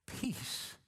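a hushed, unvoiced peace; whispered peace. Real, not acted. Pastor to congregation, whispering the word peace.

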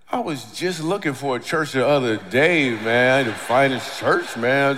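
loud, hammed-up eagerness; eager pretence. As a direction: overplay sudden keenness for church. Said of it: "And I was like".

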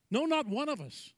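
ordinary talk, nothing marked

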